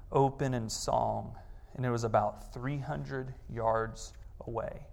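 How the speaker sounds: reading Narnia to his daughters, soft and slow; story reading. A narrator's reading. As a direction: let the passage settle in slowly and quietly. Reads Narnia passage aloud.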